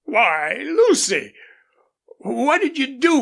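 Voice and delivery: startled father crying her name; alarmed exclamation. Acted. Father startled by daughter's wild horse pick, crying Lucy.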